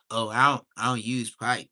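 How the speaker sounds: usual speaking voice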